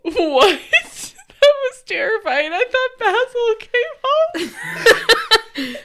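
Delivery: high, loud, laughing alarm; real startled fright. Real, not acted. Co-host spooked by a phone assistant talking, startled fright. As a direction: squeal it out, shocked and giggling.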